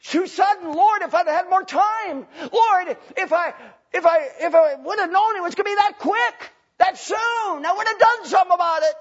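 high wailing plea, building to a shout; anguished regret. Acted. Preacher voices the dead pleading with Lord, loud anguished regret. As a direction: cry out in loud, hammed-up regret. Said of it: "A regretful attitude".

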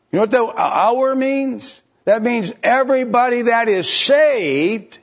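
high, loud, driving sermon voice; preaching at full force. Acted. Preacher driving the verses home to the congregation, loud and high. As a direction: press the point home to the pews.

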